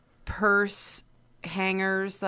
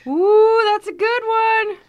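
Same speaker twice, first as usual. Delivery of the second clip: high, loud thrilled ooh; excited delight; 14 semitones higher, 9 dB louder than usual. Real, not acted. Co-host hears Hansel and Gretel is on the list, thrilled. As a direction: squeal with delight at the pick.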